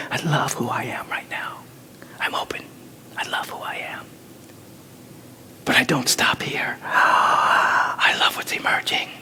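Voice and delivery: whispered affirmation. Acted. Preacher guiding congregation, whispering self-love. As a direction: murmur it to yourself.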